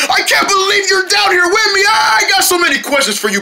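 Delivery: high-pitched gushing; squealing delight, pitched high; overjoyed greeting. Acted. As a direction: gush excitedly at finding a friend. Overjoyed at finding a friend.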